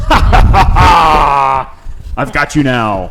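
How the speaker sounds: slow gloating villain laugh; supervillain. Acted.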